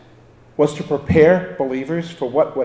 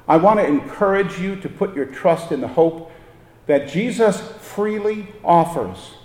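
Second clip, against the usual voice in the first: ordinary talk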